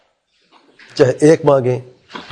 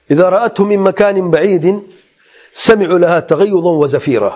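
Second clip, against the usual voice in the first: raised, chanted Arabic verse; Quran recitation; 6 semitones higher than usual. Acted. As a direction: recite the verse in a high, sung tajweed line.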